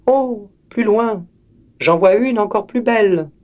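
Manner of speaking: put-on girl spotting a prettier flower, high and delighted; delighted little girl. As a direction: gasp at the next flower like a child.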